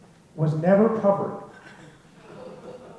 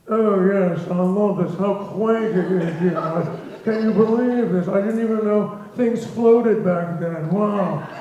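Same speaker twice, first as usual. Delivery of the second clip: a light mock of a gushing rich sailor, slower in places; snooty yachtsman; slower than usual. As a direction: lightly send up the posh guests marvelling at the old boat. Rich yachtsmen come on board.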